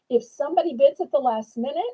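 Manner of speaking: usual speaking voice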